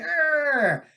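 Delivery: put-on high little growl of a flexing kid; mock flex growl. Dad imitating his son's flexing growl, high and playful. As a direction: growl like a proud toddler showing muscles.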